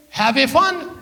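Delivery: put-on slow "have a fun" in a Punjabi accent, with the extra "a"; mom's Punjabi accent. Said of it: "Mom, it's have fun".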